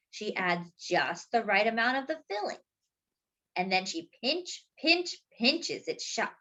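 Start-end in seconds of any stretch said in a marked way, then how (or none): none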